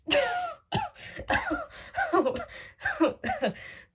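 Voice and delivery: long high wheezing mock cackle; hammed-up fake laughing fit. Shown as a demonstration. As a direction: laugh as if having the best time. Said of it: "act like you are just having the best time".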